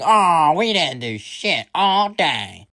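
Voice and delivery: put-on slow, high whine of a cross kid; mock childish outrage; angry whiny kid. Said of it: "they always get angry".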